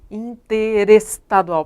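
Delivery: "interestadual" very slow as in-te-res-tadual, stretching te and res; syllables as spoken. Shown as a demonstration. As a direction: draw out "interestadual" with r opening the third syllable. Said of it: "eu falo interesse".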